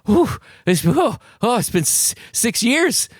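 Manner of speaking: high-pitched, hurried mock excitement; mock exhilarated relief. Reviving a Bond movie after six years, mock excited. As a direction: puff and exclaim in a high voice. Mimics returning franchise after long gap.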